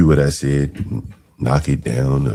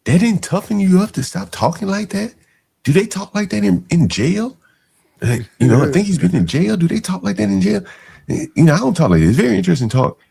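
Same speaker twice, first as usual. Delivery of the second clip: incredulous mockery. Real, not acted. Incredulous mocking of guests.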